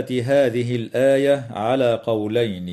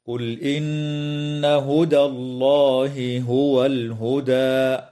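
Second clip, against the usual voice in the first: slow melodic chant with a pause at the end; Quranic recitation; 2 semitones higher, slower than usual. Shown as a demonstration. Tajweed teacher demonstrating the stop, slow melodic recitation. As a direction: chant the verse slowly as a tajweed model. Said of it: "هكذا".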